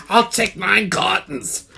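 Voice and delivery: slow, gullible buyer ordering; lightly mock a clueless shopper, slowly; dopey customer. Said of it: "as you fucking go".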